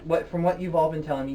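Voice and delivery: ordinary talk, nothing marked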